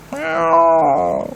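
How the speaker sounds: high drawn-out meow; cat meow. Acted. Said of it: "said Tom".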